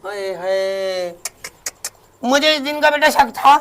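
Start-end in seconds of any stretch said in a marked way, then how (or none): 0.0-1.1 mock lament. Mother hears bride's boast.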